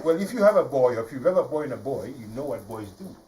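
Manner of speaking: usual speaking voice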